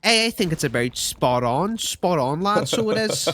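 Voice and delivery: put-on laying the Dinko accent on thicker. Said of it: "Little bit, little bit thicker".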